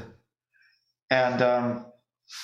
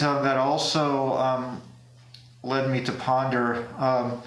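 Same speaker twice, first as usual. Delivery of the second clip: normal talking voice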